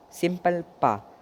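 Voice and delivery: Simple पा, drawn out slowly; slow clear pa. Shown as a demonstration. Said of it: "इसका sound हो जाता है पा". (पा को धीरे और साफ़ बोलना)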